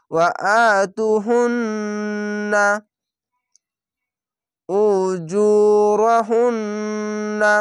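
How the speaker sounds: very slow ওয়া আতু হুন্না উজুরাহুন্না with the ন্ন held nasal; nasal ghunna recitation. Shown as a demonstration. Teacher recites the full verse with ghunna on হুন্না. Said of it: "এখানে ওয়াজিব গুন্না".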